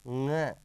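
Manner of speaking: ng held as a single nga from the nose; nasal pronunciation. Shown as a demonstration. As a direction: model ng slowly as nasal nga. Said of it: "इसका शुद्ध उच्चारण के लिए". (ng को नाक से "ङ" बोलकर दिखाएँ)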